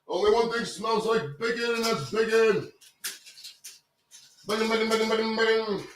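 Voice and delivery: high-pitched mock dog voice from a commercial; imitating ad dog. Host parroting a dog snack commercial, high dog impression. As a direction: squeak it out like the bacon-crazed ad dog. Mimics the bacon ad's dog.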